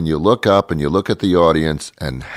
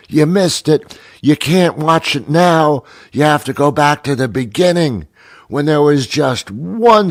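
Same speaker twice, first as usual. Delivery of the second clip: high-pitched, insistent know-it-all fan; exaggerated, emphatic imitation of pushy fans; mock nagging fans; 9 semitones higher than usual. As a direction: lecture them like an obsessive fan, pitched up. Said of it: "people tell me".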